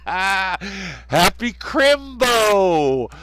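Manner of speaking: high, loud, hugely drawn-out cheer; hollered greeting. Acted. Host greeting Gaz in slang, long hollered cheer. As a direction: stretch the last word into a long holler.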